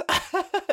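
quick high giggle; high laugh. Real, not acted. Joking about teen boners: real high giggle. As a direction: crack up at yourself.

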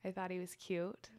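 ordinary talk

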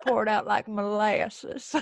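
slow Southern molasses drawl; playful, drawn-out Southern imitation; Southern accent. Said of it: "people really do talk like that".

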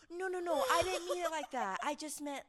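high, loud, frantic-sounding denial; retold flustered backpedalling. Worker backpedalling to the customer who offered to help, mock panic. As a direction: rush to undo the misunderstanding, hammed-up.

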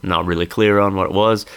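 ordinary talk, nothing marked

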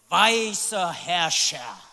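slow, low, amused pronouncement; grand slow reveal. Real, not acted. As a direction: draw out the title for comic weight. Comic reveal of name's meaning.

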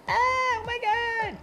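very high-pitched, drawn-out fake shriek; mock scream. Showing the co-host what they won't do, mock shriek. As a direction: parody an over-the-top horror scream. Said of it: "I'm definitely not gonna scream".

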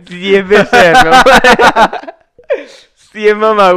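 cracking up mid-word; can't get through it for laughing; laughing fit. Real, not acted. Friend bursts out laughing before reading the twister. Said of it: "no te rías".

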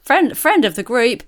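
usual speaking voice

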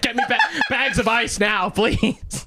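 high hurried, laughing; frantic plea. Retold or imitated. Burned cook asking coworkers for ice, frantic. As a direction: plead for ice.

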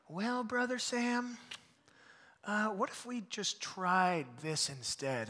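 slow, soft, patient nudge; gently steer the eager youngster; gentle mentor. Retold or imitated. Don redirecting young Sam's idea, slow and gentle. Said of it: "He's like".

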